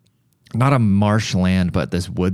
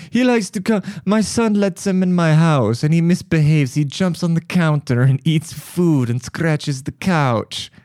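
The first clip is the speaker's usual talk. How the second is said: mock complaining lady; 9 semitones higher than usual. Russian neighbor complaining about the cat, high mock voice. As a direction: squeak out her grievance mockingly.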